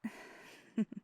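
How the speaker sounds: short breathy chuckle. Acted. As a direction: let out a small airy laugh. Amused by partner's praise.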